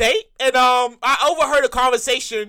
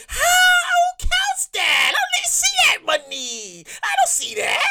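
high, wailing complaint; mock crying; slower than usual. Real, not acted. Complaining to viewers about taxes, high mock wail. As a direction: whine it like you're about to cry.

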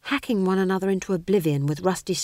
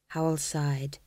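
usual speaking voice

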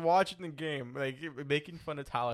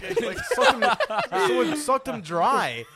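high-pitched laughing, slowing down; can't stop laughing while speaking; 9 semitones higher, 11 dB louder, slower than usual. Real, not acted. Cracking up mid-story.

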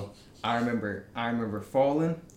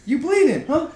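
mocking; 14 semitones higher, 8 dB louder than usual. Mocking teammates on court.

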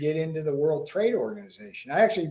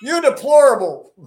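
high, loud, scolding politician impression; Hillary Clinton; 9 semitones higher, 9 dB louder than usual. Guest mimicking a politician scolding voters, Hillary impression. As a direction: denounce them in a raised, mimicking voice. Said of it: "I can do a good Hillary impression".

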